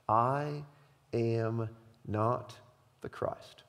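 slow, hushed, word-by-word declaration; solemn confession. Acted. John answers the priests who ask who he is, solemn and slow. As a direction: let each word stand alone, softly. John's emphatic confession, read slowly.

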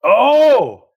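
loud, high shocked cry; surprised. Real, not acted. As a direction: yelp in surprise. Hears she was class president.

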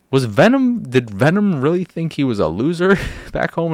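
high-pitched disbelieving question; amused bewilderment, voice pitched high; incredulous. Retold or imitated. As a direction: ask it in baffled disbelief, pitch leaping up. Incredulous reaction to the movie.

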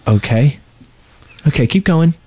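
ordinary talk, nothing marked

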